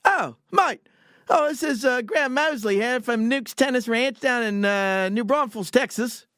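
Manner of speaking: high-pitched, hammed-up Australian caller; Australian accent. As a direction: imitate an Aussie on the phone. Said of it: "was real Australian accent".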